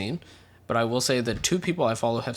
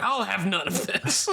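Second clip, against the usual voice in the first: higher, drawn-out mock-stern dad with a laugh; disapproving dad; 8 semitones higher, slower than usual. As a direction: play a scolding dad, half laughing. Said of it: "And he was like".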